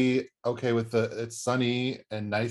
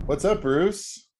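drawn-out high greeting; 8 semitones higher, 3 dB louder, much slower than usual. Acted.

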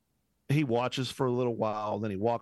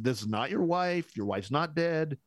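higher-pitched, puzzled protest; mild retold disbelief; 6 semitones higher than usual. Acts out disbelief at man's confession.

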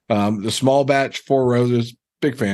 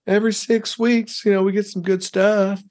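bad Tennessee accent; 10 semitones higher than usual. Imitating the liquor store guy for friends, hammed-up Tennessee accent. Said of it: "Is that your best Tennessee accent?".

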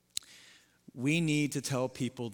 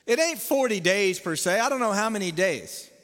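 loud, high, fervent sermon climax; preaching at full force; 7 semitones higher, 6 dB louder than usual. Acted. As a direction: drive it home to the congregation.

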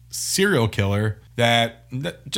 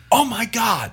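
high startled mock yell; imitated yell; 11 semitones higher, slower than usual. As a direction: yell it in shock, pitched way up. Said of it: "she yells".

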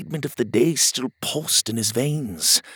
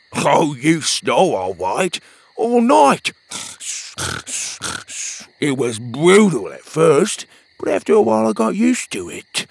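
louder, higher teasing friend voice; 6 semitones higher, 6 dB louder than usual. Acted. Petey teasing Pockets about his snoring, loud teasing voice. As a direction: rib your buddy playfully.

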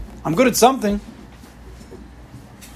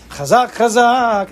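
slow, higher and strong, each word drawn out; said out loud with strength; 7 semitones higher, 4 dB louder, much slower than usual. Shown as a demonstration. Teacher shows how to say chazak with strength, slow and strong. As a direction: proclaim it with strength. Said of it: "We say it out loud".

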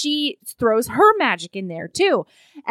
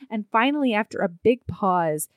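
usual speaking voice

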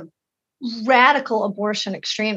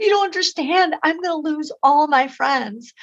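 imitating a distressed girl in a higher voice; high, mildly acted tearful protest; retold tearful sobbing; 6 semitones higher than usual. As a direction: plead as the upset girl, lightly imitated. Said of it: "started streaming tears and sobbing".

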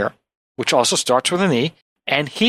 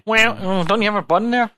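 ordinary talk, nothing marked

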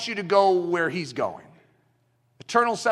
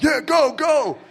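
louder, higher cheer of go, go; imitating a cheering fan; 6 semitones higher, 4 dB louder, slower than usual. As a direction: cheer on the fighters from the crowd.

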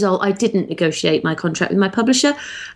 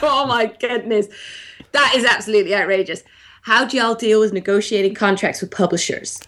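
lightly voiced sassy American accent; 3 semitones higher than usual. Shown as a demonstration. Reading a listener's question in sassy American accent. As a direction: give it a little American sass.

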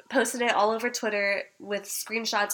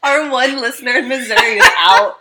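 words squeezed out through laughter; laughing; 10 dB louder than usual. Real, not acted. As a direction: laugh while you say it.

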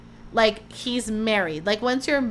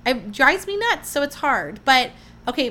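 normal talking voice

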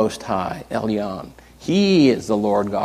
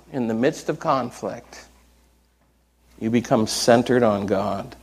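ordinary talk, nothing marked

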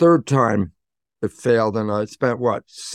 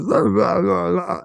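higher-pitched mock blathering; rambling patient; 7 semitones higher, slower than usual. Patient going on to the psychologist, mock rambling. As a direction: imitate a patient babbling on. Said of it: "every patient that came in started talking".

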